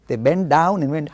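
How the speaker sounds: usual speaking voice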